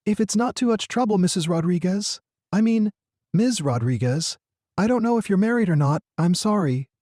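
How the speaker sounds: put-on high-pitched child voice, apologetic; shy boy, flustered. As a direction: fumble politely like an awkward kid.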